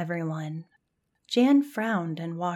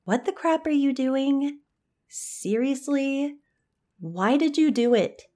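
confused; 7 semitones higher than usual. Acted. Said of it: "Melanie's anger switched to confusion".

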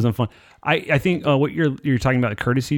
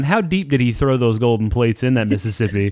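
ordinary talk, nothing marked

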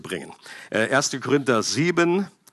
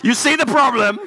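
hoch und lachend; laughing; 10 semitones higher, 5 dB louder than usual. Real, not acted. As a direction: lacht ungläubig los. Bursts out laughing mid-reading. (lachend gefragt)

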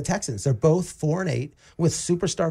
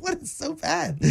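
much higher, incredulous retort; retold disbelief; 13 semitones higher, 4 dB quieter, slower than usual. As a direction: replay your shock at the caller, pitched way up. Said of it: "I'm like".

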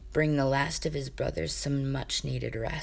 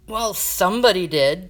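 louder, insistent accusation; asserted loudly; 4 semitones higher, 9 dB louder than usual. Acted. Jaden accusing Sasha, loud and insistent. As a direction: insist hard, voice raised. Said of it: "Jaden asserted".

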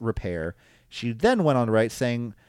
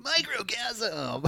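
very slow, much higher, stretched; drawn-out announcer; 12 semitones higher, 5 dB quieter, much slower than usual. Pretend. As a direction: stretch it like a show title. Drawn-out joke title.

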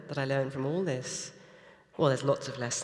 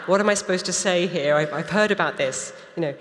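flustered; 4 semitones higher, 8 dB louder than usual. Retold or imitated. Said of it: "And I was like".

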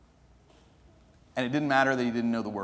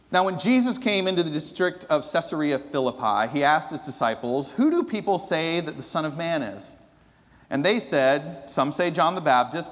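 louder, higher solemn reading aloud; scripture reading; 5 semitones higher, 4 dB louder than usual. Acted. Pastor reading Matthew to the congregation, solemn proclaiming. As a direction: proclaim the passage to the church.

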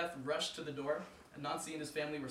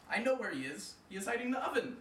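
higher, eager; excited realization; 8 semitones higher, 4 dB louder than usual. Acted. Anansi to himself, searching for his son, excited. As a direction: sure he's found the hiding spot.

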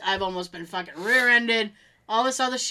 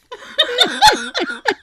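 high giggling laugh; helpless high laugh; laughing burst; 13 semitones higher, 7 dB louder than usual. Real, not acted. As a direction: break into a real laugh.

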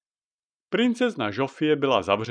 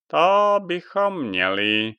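witch Jaga; 5 semitones higher, 5 dB louder, slower than usual. Acted. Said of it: "řekla dáma Jaga".

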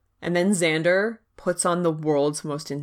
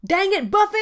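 high, hammed-up Iowan farm-boy accent; mock an Iowan twang, pitched up; Riley's Iowan accent; 11 semitones higher, 3 dB louder than usual. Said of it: "this accent is really making me write".

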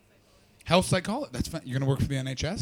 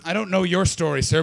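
usual speaking voice

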